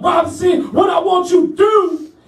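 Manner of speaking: high, strained order; imitated drill sergeant. Sergeant punishing trainee with an order, imitated commanding voice.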